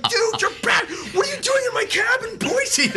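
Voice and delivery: loud falsetto imitation of an amazed fan; starstruck disbelief. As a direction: squeal in utter disbelief, mimicking the driver.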